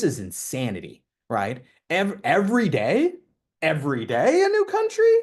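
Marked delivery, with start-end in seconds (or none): 1.9-5.1 high-pitched, loud disbelief; shrieking mock amazement; incredulous squeal. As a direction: squeal it like you can't believe it. People reacting to his travels.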